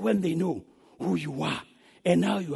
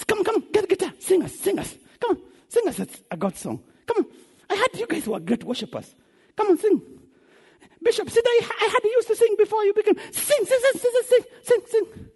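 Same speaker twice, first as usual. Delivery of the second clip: high, badgering taunt; mocking demand; 13 semitones higher than usual. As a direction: pester them to sing in a high jeering voice. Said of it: "These people are mocking us".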